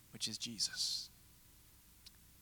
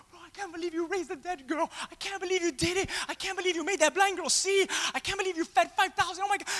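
higher, breathless awe; excited gushing; 9 semitones higher than usual. Retold or imitated. Disciples gushing to Jesus about the miracle, high and thrilled. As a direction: gush with amazement.

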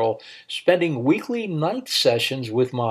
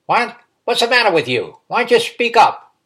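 loud, much higher, demanding; impatient badgering; 10 semitones higher, 6 dB louder than usual. A narrator's reading. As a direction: push him to speak up. Old uncle badgers timid nephew.

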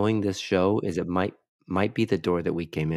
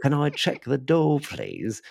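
pleading in a put-on Australian accent; broad Australian guest, falling away on the last word; imitation Australian caller; mock Australian accent; 7 semitones higher than usual. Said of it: "Every Australian".

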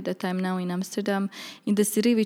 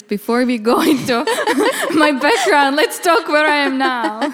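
laughing; 6 semitones higher, 8 dB louder than usual. Real, not acted. Laughing while dodging the background question.

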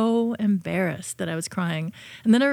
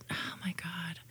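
breathy whispered oh my God; hushed embarrassed whisper; whispered aside; 2 semitones lower, 14 dB quieter than usual. Real, not acted. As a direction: drop to a whisper, remembering. Embarrassing childhood memory.

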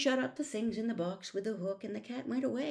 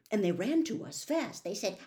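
usual speaking voice

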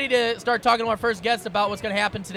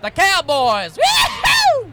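loud cowboy whoop; 8 semitones higher, 8 dB louder, much slower than usual. Pretend. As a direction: holler it like a rodeo cowboy. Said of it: "That's your cowboy voice?".

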